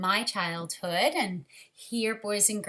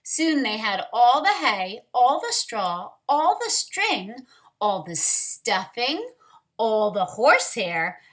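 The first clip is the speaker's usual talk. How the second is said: lively, expressive storybook reading, louder than her chat; reading to children; 7 dB louder than usual. Acted. Teacher reading a picture book to children, lively storyteller voice. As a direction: bring the picture book alive for the class.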